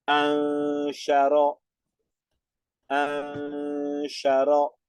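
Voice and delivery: "Ansharo" very slow, with the nun hummed through the nose; nasalized recitation. Shown as a demonstration. Teacher demonstrating the nasalization for the students, long and humming. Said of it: "Entonces nasalizamos".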